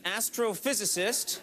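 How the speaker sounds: ordinary delivery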